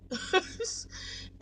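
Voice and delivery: airy rising laugh of disbelief; a breathy laugh at his comment. Real, not acted. Laughs at the driver's remark.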